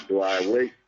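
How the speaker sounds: usual speaking voice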